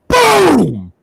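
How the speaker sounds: loud, high mimicked thunder boom; big imitated thunderclap. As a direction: belt out the crash of thunder. Retelling the thunder waking him.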